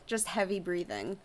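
usual speaking voice

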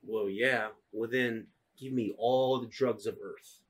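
low, soft conspiratorial sheriff; a hint of a shady lawman. Sheriff dealing drugs to a priest, low and soft. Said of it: "Sheriff's like".

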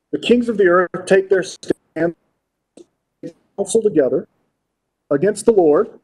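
preacher reading the psalm aloud to the congregation; scripture reading. Acted.

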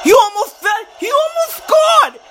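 high-pitched yell at a near miss; agitated disbelief. Real, not acted. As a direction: build from startled to screeching disbelief.

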